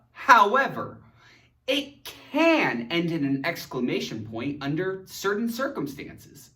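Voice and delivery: ordinary delivery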